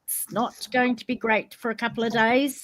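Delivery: ordinary talk, nothing marked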